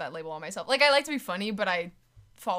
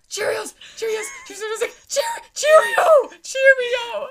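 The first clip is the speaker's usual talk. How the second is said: high, loud urgent prompting; hissing answers at a friend; whispered prompting; 11 dB louder than usual. Retold or imitated. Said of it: "she's like".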